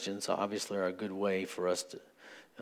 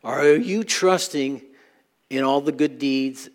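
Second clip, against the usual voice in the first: louder, higher preaching voice pressing the room; preaching at full force; 3 semitones higher, 7 dB louder than usual. Acted. Pastor urging the congregation and internet listeners, building preaching. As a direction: drive the challenge home to the congregation.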